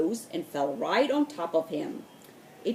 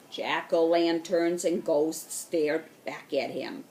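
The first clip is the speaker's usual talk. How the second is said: usual speaking voice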